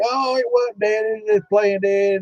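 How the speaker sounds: pitched well up, sing-song mock of the sceptics; mocking doubters. Mimicking doubters of the story.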